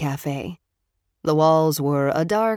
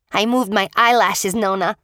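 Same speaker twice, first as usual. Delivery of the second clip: put-on cheeky little boy; 4 semitones higher, 5 dB louder than usual. A narrator's reading. Boy teasing grandmother, giggling.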